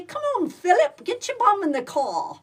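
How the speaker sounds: high, loud mock-British scolding. Repeating a royal running joke to Philip, mock British. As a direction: hustle Philip along in a hammed-up British voice.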